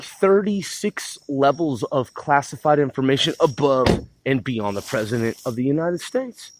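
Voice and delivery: normal talking voice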